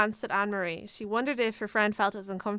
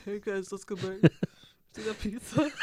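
laughing. Pretend. Acting the boys, laughing.

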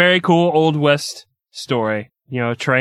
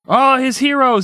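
ordinary talk, nothing marked

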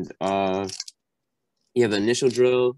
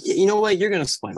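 friendly announcement; 10 semitones higher, 4 dB louder than usual. Retold or imitated. Quoting the helpful neighbor.

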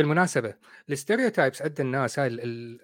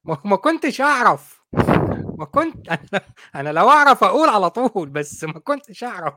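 laughing protest; 5 semitones higher, 8 dB louder than usual. Real, not acted.

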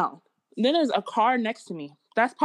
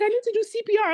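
much higher-pitched mimicry; imitated insistent mom; 12 semitones higher, much faster than usual. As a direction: quote an urgent mother in a high voice. Retells mom insisting on helping.

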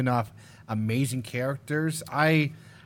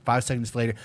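ordinary delivery

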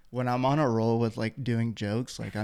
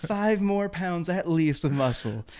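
higher, slow hammed-up gym bro boast; mock tough guy; 7 semitones higher than usual. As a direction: stretch out the brag like a proud lifter. Coworker boasting he'll bulk up.

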